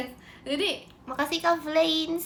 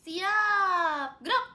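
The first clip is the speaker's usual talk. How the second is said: stretched high call without shouting, then short "gerak"; drawn-out parade command, raised pitch; drill commander; 5 semitones higher, much slower than usual. Pretend. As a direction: call a squad to attention at ordinary volume. Giving marching drill commands.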